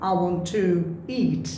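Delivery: "to" said as a full TOO before "eat"; full form. Shown as a demonstration. Teacher showing to before a vowel, full form. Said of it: "তখন ফুল উচ্চারণ করতে হবে".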